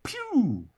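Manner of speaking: high mock zoom noise; whoosh sound effect. Speaker voicing his launch across the coals, a high whoosh. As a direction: zip off like a rocket.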